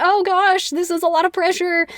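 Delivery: high-pitched, lightly hammed-up dismay; mock flustered. Christine reacting to the Phantom's ultimatum, mock flustered. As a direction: play at being overwhelmed. Voices Christine under threat.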